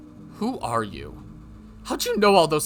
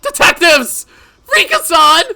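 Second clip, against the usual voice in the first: high, loud screams for rescue; desperate calling; 16 dB louder than usual. Acted. Mion calling the detectives, desperately screaming. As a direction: shriek their names.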